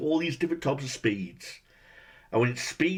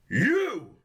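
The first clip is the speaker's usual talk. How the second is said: higher, sudden mock yell of "You!"; mock Marv shout; 8 semitones higher than usual. Critic imitating Marv spotting Kevin, mock yell. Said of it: "he looks at Kevin and goes".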